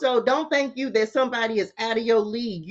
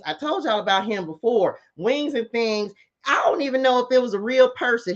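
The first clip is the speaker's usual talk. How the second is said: ordinary talk, nothing marked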